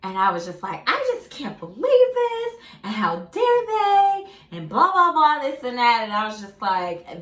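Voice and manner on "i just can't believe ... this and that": much higher, louder indignant sing-song; hammed-up outraged venting; retold rant. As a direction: rant like you've been wronged. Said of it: "let me finish my little rant".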